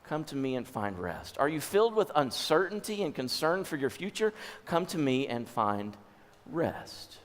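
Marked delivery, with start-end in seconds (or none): 0.0-1.4 soft, lower reply to worry; gentle invitation. Acted. Jesus answering the worried. 4.6-7.2 soft, lower, pausing before the last word; gentle invitation. Acted. Jesus answering, closing the passage.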